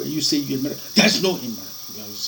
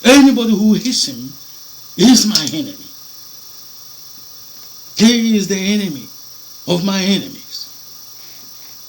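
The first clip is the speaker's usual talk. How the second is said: loud, solemn proclamation of the divine word; the preacher voices God promising to punish his enemies, loud and solemn; voicing God; 8 semitones higher, 8 dB louder than usual. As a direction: declare it as God's own vow. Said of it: "he said".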